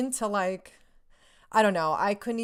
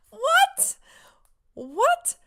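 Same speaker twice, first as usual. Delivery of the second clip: very high, loud squeal of shock; stunned disbelief; 7 dB louder than usual. Retold or imitated. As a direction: shriek it as if you can't believe your luck. Reliving shock at the hug offer.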